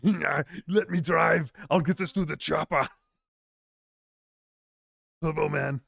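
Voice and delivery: exaggerated Austrian movie-star voice; Arnold. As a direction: bellow movie catchphrases in a mock Schwarzenegger accent. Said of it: "Arnold. He will not stop".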